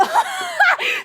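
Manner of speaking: loud, very high burst of laughter; shrieking laugh. Real, not acted. Streamer hears friend just did catchphrase, shrieking laugh.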